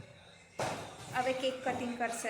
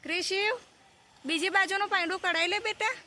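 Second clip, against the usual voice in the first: calling out; 6 semitones higher than usual. Real, not acted. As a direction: call across to your son to take off the other wheel. Mother calls son across yard. (દૂરથી દીકરાને બૂમ પાડીને બોલાવવું)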